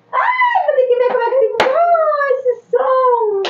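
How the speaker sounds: very high squealing voice. Real, not acted. (voz esganiçada)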